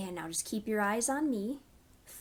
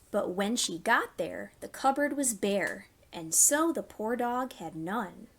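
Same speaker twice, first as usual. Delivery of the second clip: gentle storytime rhyme; reading to children. Acted. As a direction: read it to little ones, pausing for them. Reads a nursery rhyme.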